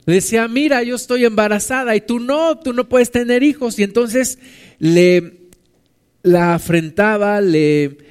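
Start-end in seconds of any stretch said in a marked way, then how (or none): 0.5-3.8 mocking. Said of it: "Le hacía burla".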